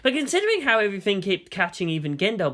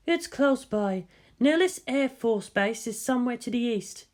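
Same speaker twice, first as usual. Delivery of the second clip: higher, slow, calm female voice; female officer voice; 7 semitones higher than usual. Acted. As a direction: read off the console coolly. Ritsuko voiced at console.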